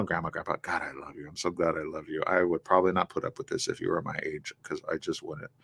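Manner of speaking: very low, soft murmur of loving impatience; unspoken thought. Retold or imitated. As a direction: keep it in your head, barely voiced. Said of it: "in your head you do that".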